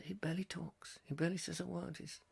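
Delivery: barely audible whisper; Anthony Hopkins. Retold or imitated. Presenter showing how little the actor talks, hushed whisper. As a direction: murmur it with hardly any voice. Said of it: "I like Anthony Hopkins 'cause he barely opens his mouth".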